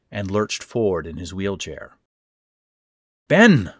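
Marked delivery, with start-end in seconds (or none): 3.3-3.7 sudden loud high cry of a name; cried out. Acted. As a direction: shout his name in alarm. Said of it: "I cried and ran over".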